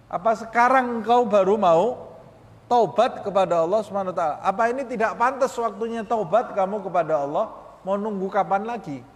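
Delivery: preacher demanding repentance from the soul; urgent rebuke. Shown as a demonstration. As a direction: press the soul to repent now. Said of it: "Tanya kepada nafsu kita".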